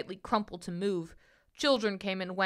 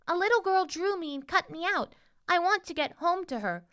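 high, earnest princess voice; storybook princess; 9 semitones higher than usual. A narrator's reading. Princess tells the boy of her girl, high voice. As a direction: tell your story as a little princess, pitched up.

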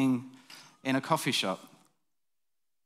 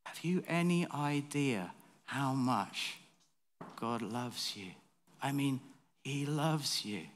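much softer and slow, sincere; tender, earnest; 8 dB quieter, slower than usual. Retold or imitated. The preacher tells a homeless man God loves him. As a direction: tell him gently how much he is loved. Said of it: "And I said".

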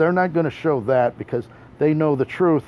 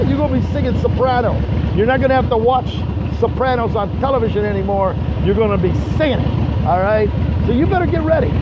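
raised, forceful hype-man delivery; big declamatory trash talk; hyped promo; 8 semitones higher, 3 dB louder than usual. Acted. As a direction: work the crowd like a wrestling promo. Promo to Scotty continues.

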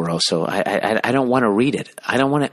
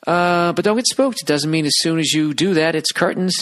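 high-pitched mock letter voice; mocking read-out; 8 semitones higher than usual. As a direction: read it out high, ridiculing the writer.

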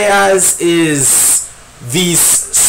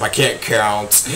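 mock self-deprecating; 7 semitones lower, 5 dB quieter, slower than usual. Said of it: "No, I'm kidding".

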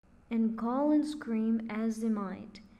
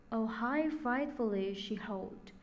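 ordinary delivery, nothing marked

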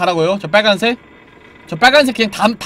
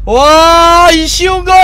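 흥분한 외침; 8 semitones higher, 10 dB louder, much slower than usual. Real, not acted.

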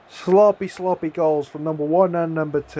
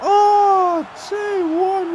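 ecstatic cheer; much slower than usual. Real, not acted. Gamer celebrating his team's lead, shrieking with joy. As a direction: scream for the go-ahead goal. Team scores before half-time.

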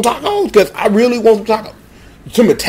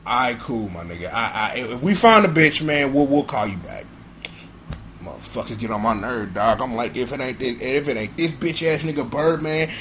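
much lower and softer muttering; grumbling under breath; 6 semitones lower, 9 dB quieter than usual. Acted. Hanging up, annoyed, muttering softer and lower. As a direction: mutter it all to yourself.

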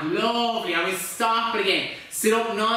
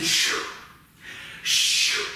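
dryer whoosh. Pretend. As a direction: breathe out a long sh like a machine turning. Imitates the tumble dryer noise.

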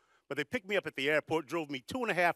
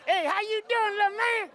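big Missouri woman; 6 dB louder than usual. Retold or imitated. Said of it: "People were walking up to me going".

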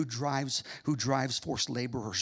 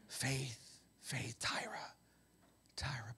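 hushed whispered name; whispered call; 3 semitones lower, 14 dB quieter, slower than usual. Pretend. God whispering a listener's name.

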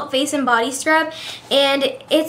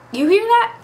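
excited; 8 semitones higher than usual. Real, not acted. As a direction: share the news with a thrill. Excited about countdown.

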